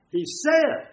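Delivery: "said" punched high; "said" leaping up in pitch to mark the correction; insistent stress. Retold or imitated. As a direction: hit the word "said" hard and high. Priests insist on changed wording.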